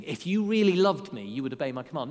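a stern, accusing read of the verse; mock aggressive demand. Shown as a demonstration. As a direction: say it as a harsh, loud demand. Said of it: "an aggressive thing".

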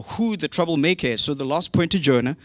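ordinary talk

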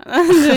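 sudden laugh bursting out; loud burst of laughter. Real, not acted. Laughs at being imitated.

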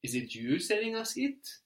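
"Is it you selling us it?" with a high rise on "you"; emphatic question. Shown as a demonstration. Teacher models the question, rising high on "you". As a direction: lift "you" as the focus of the question. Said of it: "what if we say".

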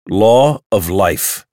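a bitter man snapping out a rule; sharp. Acted. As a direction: hit each word like a verdict. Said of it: "he said sharply".

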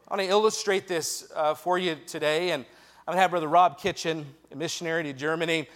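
ordinary talk

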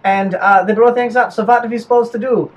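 chatty, explanatory mock German accent. German explaining his memo and grocery list, chatty German accent. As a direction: justify it in a comic German accent.

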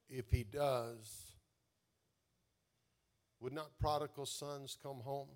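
low, measured questions with long pauses; reflective preaching. Real, not acted. Preacher invites congregation to believe for healing, low and measured. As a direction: pose each hope gently and let it hang.

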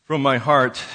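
usual speaking voice

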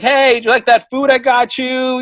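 butter her up, sweetly; forced friendliness to the landlady; fake nice. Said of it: "I'm trying to fucking just be nice".

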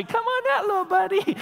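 mock sweet talk to a snake; coaxing a pet. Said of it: "You don't just reach down and say".

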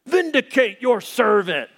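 high, slow, shouted hellfire prayer; fiery mock prayer. Stranger in a parking lot, shouting prayer at a doubter, high and slow. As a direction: call down fire from heaven, preaching hard. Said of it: "And then he starts praying".

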